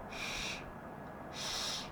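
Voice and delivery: voiceless rush of air on sh; drawn-out sh. Shown as a demonstration. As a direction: let a long "shhh" pour out. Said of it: "খুব জোরে বাতাস বেরোবে". (শিনের বাতাস ভরা শ ধ্বনি)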